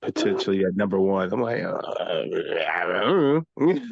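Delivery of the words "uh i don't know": slow, drawn-out unsure reply; doubtful. Retold or imitated. Friend retells her reaction to the stats, slow and doubtful. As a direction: stretch it out, not convinced.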